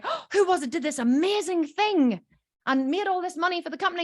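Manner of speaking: high-pitched, gushing amazed question; gasp, then gush with admiration; delighted praise. Acted. Boss praising work that made the company money, gushing. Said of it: "responsibility is a really good thing".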